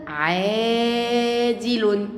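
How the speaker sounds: "عادل" with the first aa held very long; long vowel. Shown as a demonstration. Teacher shows first graders the madd, drawing out aa in "عادل". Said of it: "حركة طويلة".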